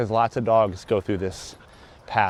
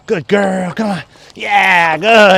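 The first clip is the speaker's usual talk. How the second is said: excited dog praise; 7 semitones higher, 9 dB louder, much slower than usual. Real, not acted.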